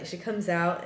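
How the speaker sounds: ordinary delivery, nothing marked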